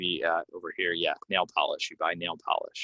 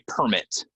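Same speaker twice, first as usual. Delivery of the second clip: "permit" stressed as PER-mit; first-syllable stress; 2 semitones higher, 3 dB louder than usual. Shown as a demonstration. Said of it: "goes on the first syllable if it's a noun".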